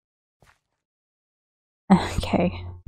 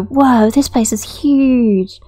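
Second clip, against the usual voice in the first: slow, drawn-out wow, slightly higher; amazed silverfish; 4 semitones higher, 3 dB louder, slower than usual. Acted. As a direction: marvel at it like an awestruck little creature. Voicing silverfish seeing the ravine.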